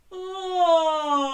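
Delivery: loud open-throated oh; big open oh like at the doctor's. Shown as a demonstration. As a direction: open your throat and call out oh. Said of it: "Can you do that?".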